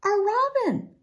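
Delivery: high-pitched delighted exclamation; excited shout. A narrator's reading. Willa spots the robin, excited shout. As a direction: exclaim it high, without much added volume. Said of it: "shouted Willa, ... jumping up".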